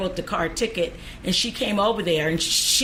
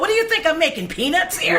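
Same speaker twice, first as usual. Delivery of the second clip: high-pitched incredulous challenge; indignant comeback; 7 semitones higher than usual. Retold or imitated. Traffic officer challenging a driver who mocked her pay, indignant. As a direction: throw the question back, pitched way up.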